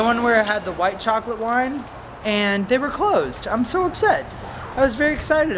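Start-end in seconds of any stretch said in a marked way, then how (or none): 3.5-5.6 high whiny mock upset. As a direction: whine it, hammed-up disappointment. Closed wine shop.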